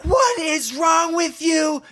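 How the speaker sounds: high, slow mock shout; hammed-up indignant yell; shouting at Mike. Retelling old couple's outburst.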